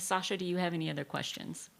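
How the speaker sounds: ordinary talk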